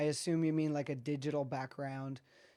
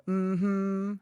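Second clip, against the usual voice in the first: high hummed "Mm-hmm", louder than the sentence; drawn-out product name; 5 semitones higher, 8 dB louder than usual. Shown as a demonstration. Software expert showing how the name is said, drawn-out high hum. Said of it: "I have to say it like that".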